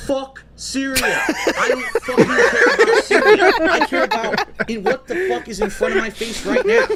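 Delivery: high, loud and slow, laughing through it; laughing rant. Acted. Performing the rant again for friends, laughing loud shout. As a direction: blast it out while cracking up.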